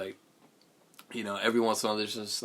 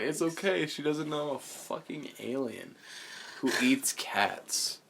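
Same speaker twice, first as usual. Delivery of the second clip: lightly put-on alien voice, pitched up; voiced sitcom alien; 6 semitones higher than usual. Retelling a sitcom alien on a blind date, mock reassuring. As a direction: voice the alien's reassurance, higher than usual. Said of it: "he was like".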